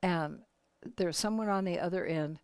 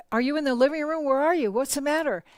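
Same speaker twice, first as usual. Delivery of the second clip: louder and higher, calling from another room; 6 semitones higher, 7 dB louder, faster than usual. Retold or imitated. Husband calling through the house for his wife, loud and worried. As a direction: call out to find her in the house.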